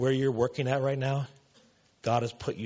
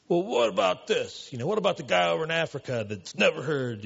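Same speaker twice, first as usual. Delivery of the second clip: mocking New York accent; 6 semitones higher, 4 dB louder than usual. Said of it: "this guy had that kind of an accent".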